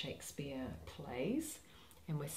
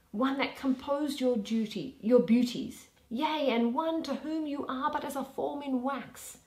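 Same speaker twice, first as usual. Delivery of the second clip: lightly voiced duke lecturing a young maid; stern duke; 6 semitones higher, 5 dB louder than usual. Duke Theseus warning Hermia to obey her father, lightly voiced stern. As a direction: counsel firmly from above.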